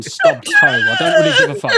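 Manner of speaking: squeaky laugh breaking through. Real, not acted. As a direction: crack up mid-sentence. Laugh breaks mid-line.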